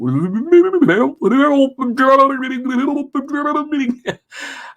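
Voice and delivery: high-pitched babbling gurgle as if drowning; mock drowning noise. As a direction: pretend to go under, babbling high. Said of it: "I'm drowning".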